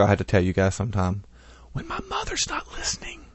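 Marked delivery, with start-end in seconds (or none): 1.7-3.3 hushed secret whisper; conspiratorial whisper. Pretend. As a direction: whisper it so mom can't hear. Secret aside about mother.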